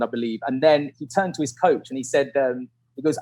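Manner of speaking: ordinary delivery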